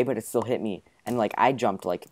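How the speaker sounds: normal talking voice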